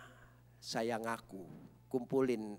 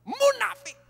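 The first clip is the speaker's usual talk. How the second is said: high, loud mock insult; furious wife; 9 dB louder than usual. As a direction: spit it out like the raging wife. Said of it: "ngamuk Ibu Yusuf".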